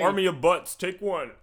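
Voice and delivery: loud, high shouted announcement; mock slate call. Host demonstrating a film slate, high mock shout. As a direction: call it out across the set.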